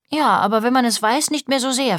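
acted small girl's reply; little girl. A narrator's reading. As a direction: answer dad in a high child voice. Child answers dad, story.

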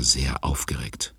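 breathy hushed whisper; whispered aside. A narrator's reading. Narrator to young listeners, a whisper. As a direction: drop to a secret whisper. Narrator stresses Bibi's excitement.

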